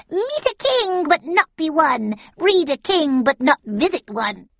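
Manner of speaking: high, loud crone chant; witch prophecy. A narrator's reading. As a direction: chant the riddle like a hag.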